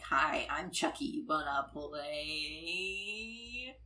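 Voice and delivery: good guy doll voice winding down; slow toy voice, last word stretched out and fading; Chucky. Retold or imitated. Host imitating the doll's last words, slow drifting toy voice. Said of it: "drifts off into, like, the good guy doll voice".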